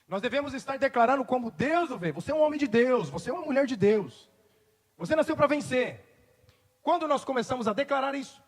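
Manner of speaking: fervent sermon climax; full-force preaching. Acted. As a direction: proclaim it over the congregation.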